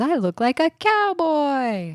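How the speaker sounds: singsong mock delight; imitated excited girl. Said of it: "And she says".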